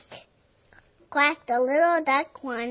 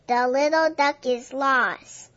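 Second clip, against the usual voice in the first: plain reading voice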